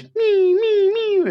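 put-on shrill child sound effect; mimicking a toddler's lightsaber buzz; toddler's lightsaber noise. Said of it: "and then goes".

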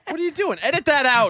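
hammed-up frantic objection; mock panic. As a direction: squawk in pretend alarm.

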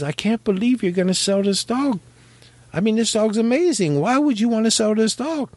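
a hint of an astonished buyer pleading; amazed customer. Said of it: "he says".

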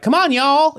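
high mock Southern accent; Angela. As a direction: holler it as a caricature Southern belle. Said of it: "Don't want Angela to be like".

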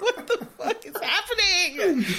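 high, incredulous outcry through laughter; laughing disbelief. Real, not acted. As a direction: cry out in helpless, laughing bafflement. Laughing outcry over baffling news.

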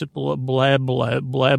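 ordinary talk

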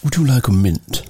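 low, politely English offer. Acted. Briton politely offering someone a mint, polite English accent. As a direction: offer it with careful English manners. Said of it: "we're all trying to be English".

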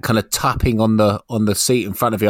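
normal talking voice

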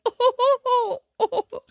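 high, drawn-out mock "oh"; teasing high-pitched ooh-oohs; imitated oohing. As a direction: mimic someone's reaction with rising oohs. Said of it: "And you went".

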